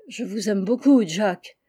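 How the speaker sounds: usual speaking voice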